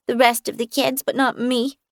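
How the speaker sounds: talking around a thumb; thumb in mouth. A narrator's reading. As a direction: sad child through his thumb. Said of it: "Sam said around his thumb".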